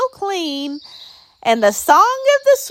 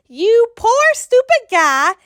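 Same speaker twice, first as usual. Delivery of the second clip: louder, higher taunting jab; mocking laugh-through; 6 semitones higher, 5 dB louder than usual. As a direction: sneer at him, gloating. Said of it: "I laughed at the Lorax".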